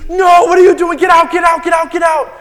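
high, loud, frantic get out; panicked kid yelling. Retold or imitated. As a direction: shout like a scared child guarding the chair. Friend yelling at him.

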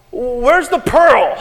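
loud, drawn-out mock demand. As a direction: stretch it out in exaggerated searching. Mock newcomer demanding the pearl.

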